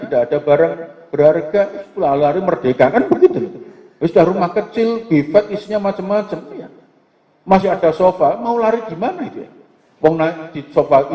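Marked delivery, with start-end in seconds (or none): none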